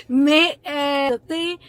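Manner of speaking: holding back tears on the word adoptée; voice breaking. Real, not acted. To her viewers, about being adopted, voice cracking with tears.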